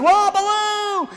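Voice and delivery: loud, high, drawn-out call; acted yell as if carried away; alarmed cry for help. Mowgli carried off by monkeys calling Baloo, alarmed cry.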